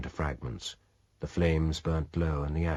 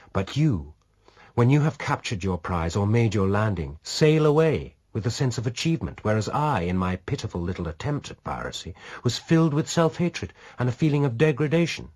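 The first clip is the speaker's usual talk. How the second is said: a hint of bitter self-reproach; raised, earnest woman's voice; lightly voiced earnest woman; 6 semitones higher, 4 dB louder than usual. A narrator's reading. Dona confesses her shame.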